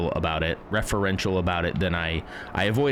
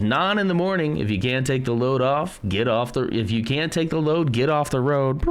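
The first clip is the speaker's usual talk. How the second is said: higher-pitched radio DJ catchphrase; show slogan; 5 semitones higher, 4 dB louder than usual. Pretend. Streamer playing with soundboard, show slogan. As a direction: call it out like a show jingle.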